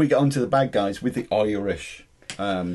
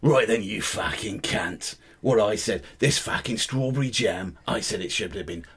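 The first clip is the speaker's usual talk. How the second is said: put-on bad-guy voice, softer and lower by the end; gangster role; 4 semitones lower, 4 dB quieter than usual. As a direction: play a gangster grumbling about jam. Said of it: "take it in turns to sort of be people".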